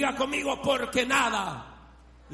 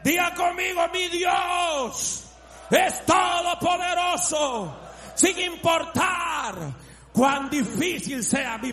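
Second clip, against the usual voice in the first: high, drawn-out preacher's shout; shouted praise; 6 semitones higher, 3 dB louder, slower than usual. Real, not acted. Preacher leading the congregation to repeat after him, shouted praise. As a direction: stretch each phrase at full voice.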